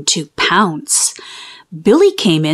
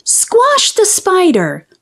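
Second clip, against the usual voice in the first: high, drawn-out order; crying out; 9 semitones higher, slower than usual. A narrator's reading. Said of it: "Joe cried".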